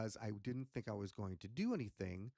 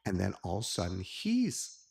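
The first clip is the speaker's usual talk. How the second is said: ordinary talk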